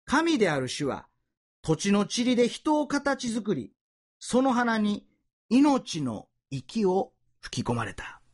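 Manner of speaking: slow, solemn Bible reading; scripture reading. Acted. Preacher reading scripture to the congregation, slow and solemn. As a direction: read it out as sacred words.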